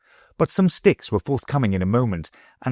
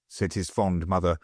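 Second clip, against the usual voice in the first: ordinary delivery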